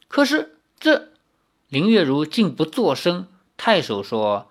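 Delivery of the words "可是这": high, faltering protest; startled protest. Acted. Lin protests the order, high and breaking off.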